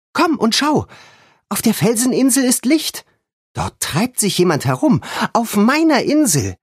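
alarmed and indignant, it's her island; excited alarm. A narrator's reading. Girl points out the light on her island, high excited alarm.

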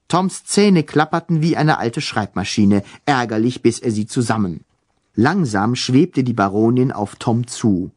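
plain reading voice

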